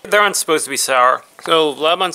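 speaking around candy; chewing while talking. Real, not acted. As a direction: mouth partly full. Seen 2: Lips near closed, jaw moving mid-speech; gummy piece held at mouth.

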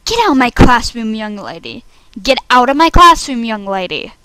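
stern teacher. Acted. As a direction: order a pupil out forcefully. Teacher expels pupil, two takes.

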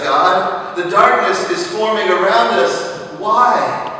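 loud, high, rising sermon cry; preacher crying out to God over slain children, fiery; fiery preaching. Acted. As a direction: lift the congregation with each why. Preaching at full force.

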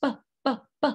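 short popped "b", evenly spaced; isolated, repeated "b" bursts; B sound. Shown as a demonstration. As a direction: pop each "b" on its own. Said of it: "what letter makes this sound".

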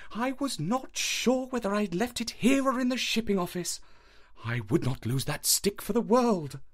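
lightly voiced nervous country doctor; Mortimer voice. A narrator's reading. Mortimer voice in read story.

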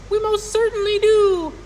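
very high, loud squeak; piping voice. A narrator's reading. Tiny mayor confirming to Horton, loud piping squeak. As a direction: pipe up eagerly like a tiny creature. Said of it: "piped the voice".

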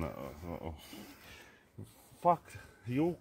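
ordinary talk

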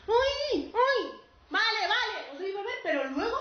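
high, loud comic yelps; yelp, then hurriedly give in; flustered, hammed-up surprise; startled. Startled by two more thirsty ghosts.

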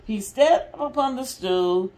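lively, expressive picture-book reading; mother reading a Disney story to restless kids, lively and expressive; reading to children. Acted. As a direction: read it out big for the little ones. Reads story aloud to kids.

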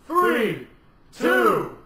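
countdown building to a high, loud final command; shouted countdown. Real, not acted. Caller starting the drinking challenge, shouted countdown.

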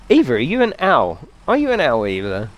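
ordinary talk, nothing marked

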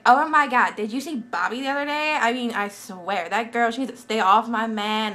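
put-on gossiping girl warning off a rival, loud; generic gossip voice. Shown as a demonstration. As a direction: gossip like a jealous schoolgirl. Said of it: "my generic anyone voice".

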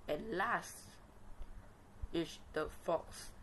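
ordinary delivery, nothing marked

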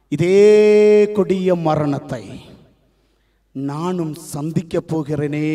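slow, sinking voice of Jesus; heavy, hushed realisation; grave inner thought. Acted. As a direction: draw the words out, dropping low and soft. Voices Jesus dreading his death.

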